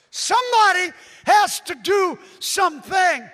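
shouted, high and loud; full shout. Real, not acted. Preacher shouting the demand to his congregation. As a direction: roar it at the crowd. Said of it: "Do I need to say that again".

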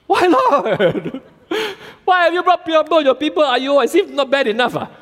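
high, loud hammed-up exasperated pleading; frustrated Moses. Moses protests to God over his suffering people, high hammed-up exasperation. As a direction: throw up your hands at God, half laughing.

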